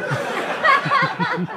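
shrieking high laugh. Real, not acted. Comic cracking up at her own story, high laugh. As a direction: burst out laughing.

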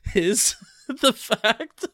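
laughing through the words; giggling as you speak. Real, not acted. Laughing while describing.

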